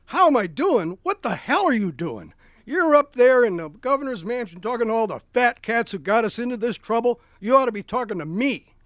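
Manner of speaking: Huey Long chewing out Roosevelt on the phone, loud and indignant; angry Huey Long. Retold or imitated. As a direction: bellow at the governor like a riled-up populist.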